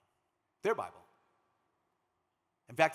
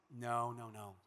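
polite refusal; 3 semitones lower, 5 dB quieter, much slower than usual. Retold or imitated. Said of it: "contractual obligation to refuse".

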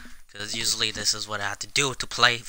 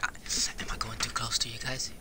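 soft sneaky whisper; 8 dB quieter than usual. Real, not acted. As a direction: whisper so nobody hears. Sneaking near other players.

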